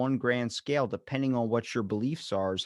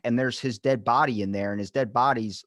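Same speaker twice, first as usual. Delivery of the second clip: ordinary talk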